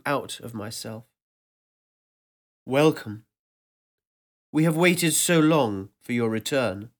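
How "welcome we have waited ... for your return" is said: a louder, booming welcome that seems to fill the air; vast ancient voice. Acted. Ancient voice welcomes the narrator back, louder and resonant. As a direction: speak as a presence that surrounds everything. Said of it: "A voice bloomed around me".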